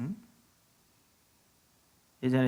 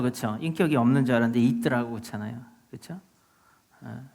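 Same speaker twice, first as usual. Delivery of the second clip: normal talking voice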